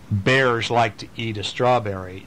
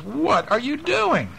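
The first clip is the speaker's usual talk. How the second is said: high playful voice; 13 semitones higher, slower than usual. Acted. Voiced character in picture book.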